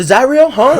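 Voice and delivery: loud, high, furious challenge; angry shout. Retold or imitated. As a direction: bark it right in his face. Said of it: "he says".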